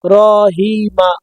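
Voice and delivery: each sound stretched very slow and apart; wrong drawn-out reading. Shown as a demonstration. Teacher shows pupils the wrong way: Rahima dragged very slowly. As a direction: drag Rahima out slowly, syllable by syllable. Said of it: "এরম বললে হবে না".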